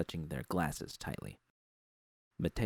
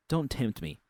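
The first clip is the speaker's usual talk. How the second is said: angry warning; 6 semitones higher than usual. A narrator's reading. Narrator threatening boss Susie before storming out, angry. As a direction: spit it as a final warning.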